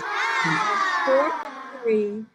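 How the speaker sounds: slow, loud, drawn-out mock protest; indignant shout. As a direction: stretch it out like a furious complaint. Retold complaint to hospital staff.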